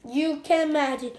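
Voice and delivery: usual speaking voice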